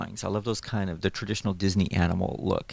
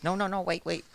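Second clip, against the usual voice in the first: lightly voiced pleading character; 9 semitones higher, 4 dB quieter than usual. Artist acts out storyboard mouse for visitors, lightly voiced plea. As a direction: lightly act out a cartoon mouse's protest. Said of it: "He goes".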